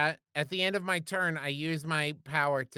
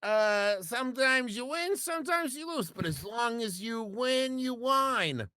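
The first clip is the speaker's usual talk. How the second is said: tipsy wine mom; 9 semitones higher than usual. Acted. In-character tipsy dwarf bit.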